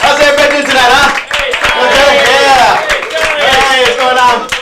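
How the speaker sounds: loud high hype-man greeting. Real, not acted. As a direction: pump the room up like a host. Comic greeting the crowd loudly.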